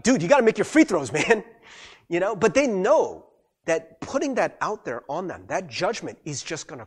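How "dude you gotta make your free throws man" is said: loud, higher exasperated complaint; secret frustration. Acted. Teammate secretly fed up with missed free throws, exasperated. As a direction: let out the held-back irritation. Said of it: "Secretly, they might be like".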